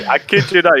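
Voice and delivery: laughing high and loud; giggling through the words. Real, not acted. Laughing reaction to voice.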